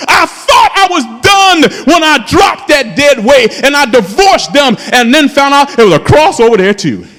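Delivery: usual speaking voice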